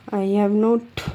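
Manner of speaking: slow, stretched reread; slow dictation. Shown as a demonstration. Teacher rereading the phrase to her students. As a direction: read it back slowly to check. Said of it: "rereading dictated phrase".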